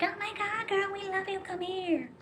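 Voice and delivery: very high squealing fan voice; gushing crowd. Retold or imitated. Townsfolk gushing over the princess, squealing high. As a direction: squeal it like adoring fans. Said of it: "they're like".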